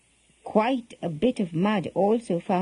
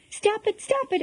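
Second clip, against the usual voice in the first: very high-pitched protest, volume held back; mock shout; 12 semitones higher than usual. A narrator's reading. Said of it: "all of them shouted".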